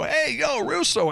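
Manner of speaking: high, eager call from the guys. Retold or imitated. Guys in the hall hail the narrator, high and eager. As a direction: hail him like a buddy in the hallway. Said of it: "these guys come up to me and go".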